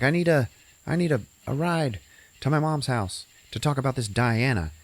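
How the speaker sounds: a hint of a worried young woman. Heroine asking her boyfriend for a ride, mildly anxious.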